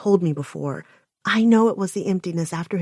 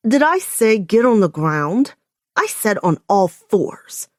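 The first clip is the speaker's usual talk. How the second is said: loud, sharp rebuke; snapping; 3 semitones higher, 6 dB louder than usual. Acted. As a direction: snap it back at him, irritated. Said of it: "I snap".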